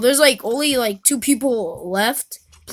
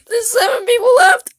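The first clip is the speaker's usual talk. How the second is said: high panicked wail; crying out in panic; 12 semitones higher, 5 dB louder than usual. Real, not acted. Stuck, panicking in game.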